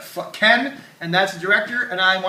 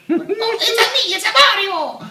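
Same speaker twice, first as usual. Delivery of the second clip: very high, mock Mario voice with put-on accent; 7 dB louder, slower than usual. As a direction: squeak it out as a silly spoof of Mario.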